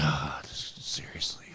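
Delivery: breathless whisper of disbelief; exasperated whisper. Retold or imitated. Caught by security mid-pee, whispering in disbelief. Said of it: "I'm like".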